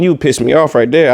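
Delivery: normal talking voice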